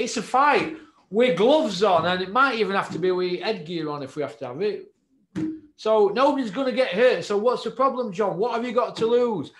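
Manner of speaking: heated push. Real, not acted. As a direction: drive it home, worked up.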